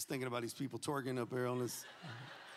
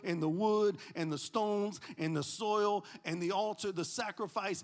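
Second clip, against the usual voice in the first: rising preaching climax; 4 semitones higher, 3 dB louder than usual. Real, not acted.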